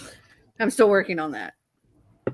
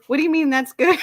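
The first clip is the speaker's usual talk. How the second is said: laughing through the quote; 7 semitones higher than usual. Real, not acted. Reading the texts aloud, breaking up.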